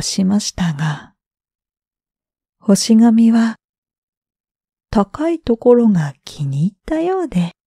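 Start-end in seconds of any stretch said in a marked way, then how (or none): none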